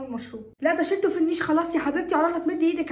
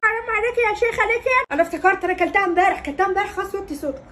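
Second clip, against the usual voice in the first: higher-voiced indignant old grandmother; a wounded granny protesting; offended grandma; 6 semitones higher, 7 dB louder than usual. Grandmother pleading then admits. (جدة زعلانة بصوت أعلى)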